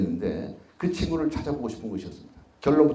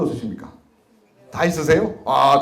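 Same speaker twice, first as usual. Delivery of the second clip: usual speaking voice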